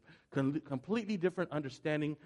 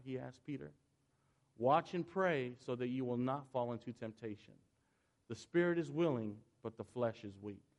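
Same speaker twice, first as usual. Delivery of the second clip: scripture reading; 5 dB quieter than usual. Shown as a demonstration. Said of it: "notice how I read the portions of Scripture".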